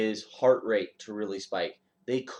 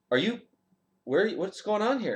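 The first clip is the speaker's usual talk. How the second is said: higher, puzzled general asking what's going on; surprised general; 11 semitones higher than usual. Retold or imitated. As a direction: let the general sound caught off guard, pitched up. General surprised to see airman.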